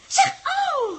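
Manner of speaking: loud, high ouch of protest; indignant yelp. Acted. Els kicked back, loud indignant ouch. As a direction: cry out as if kicked.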